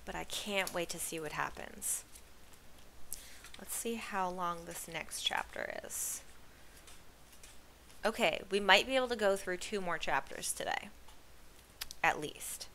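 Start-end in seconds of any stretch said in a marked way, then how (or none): none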